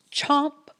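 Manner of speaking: plain reading voice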